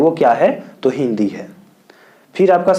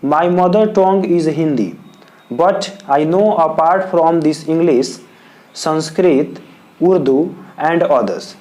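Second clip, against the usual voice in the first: slow, word by word dictation; teacher dictating the model answer to students; dictation pace; much slower than usual. Shown as a demonstration. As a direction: spell it out slowly for the notebook. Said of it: "तो answer लिखेंगे".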